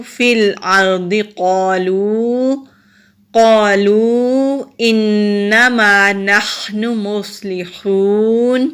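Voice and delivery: slow, melodic tajweed chant; Quran recitation. Shown as a demonstration. Teacher reciting the verse for students, slow melodic tajweed. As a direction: draw the verse out in measured recitation.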